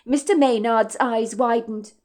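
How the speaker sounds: ordinary delivery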